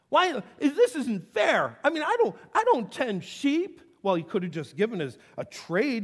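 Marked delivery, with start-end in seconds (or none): 0.1-3.8 high, slow, whiny sulk; loud petulant whining Cain; pouting grown man. Retold or imitated. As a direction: whine it like a sulking man-child. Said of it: "this grown man doing the pout".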